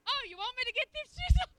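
high, loud mock exasperation; loud exasperated snap. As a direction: bark it like a fed-up wife. Wife scolding husband, retold.

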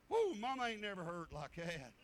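ordinary talk

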